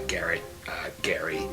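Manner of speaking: ordinary delivery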